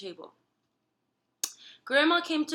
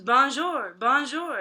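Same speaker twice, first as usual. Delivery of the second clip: lightly voiced parrot bonjour; a hint of a parrot squawk; mild bird voice; 3 semitones higher, 5 dB louder, slower than usual. A narrator's reading. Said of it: "in a bird voice".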